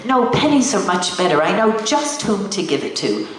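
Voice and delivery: ordinary delivery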